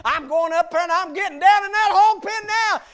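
the boss man calling back that he's going to the hog pen; determined shout. Acted. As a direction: shout back your decision. Boss man's determined answer.

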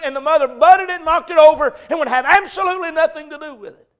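high, loud, sustained pulpit shouting; full-force preaching. Retold or imitated. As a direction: drive each phrase home at a preacher's holler.

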